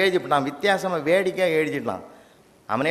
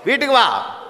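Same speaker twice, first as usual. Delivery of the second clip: வீட்டுக்கு வா shouted, higher and louder; loud order; 7 semitones higher, 4 dB louder than usual. Retold or imitated. Mother ordering her son home, shouting. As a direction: bark it at the child, raising the voice on வீட்டுக்கு.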